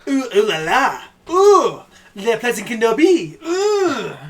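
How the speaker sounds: high, loud, hammed-up French accent; French impression. Streamer showing off a French impression, mock French accent. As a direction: squeal out exaggerated French oohs. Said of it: "up for a bit of a French impression".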